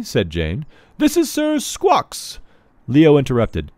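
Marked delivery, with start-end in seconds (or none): 1.0-2.4 high, slow, drawn-out announcement; grand slow herald girl. Acted. Jane presenting her last knight, drawn out and grand. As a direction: stretch the name out grandly.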